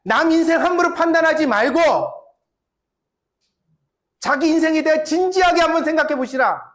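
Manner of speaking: high, loud building exhortation; fiery preaching. Acted. Pastor rebuking judgmental believers with rising fire. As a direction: rebuke the congregation at full force.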